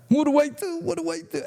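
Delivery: very high, frantic panicky voice; panicked squeal. Retold or imitated. Scared informant panicking over what to do, squealing high. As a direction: squeak it out like a terrified man. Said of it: "and he's like".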